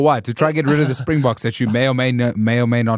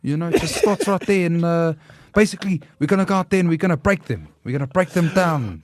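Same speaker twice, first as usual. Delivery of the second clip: higher-pitched Pacific Kiwi impersonation; put-on Pacific Island Kiwi accent; Pacific New Zealand accent; 4 semitones higher than usual. Shown as a demonstration. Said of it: "Pacific Island New Zealand accent".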